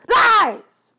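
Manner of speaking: high, loud cry of denial; accusing shout. Acted. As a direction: explode on the single word. God accuses hero in quarrel.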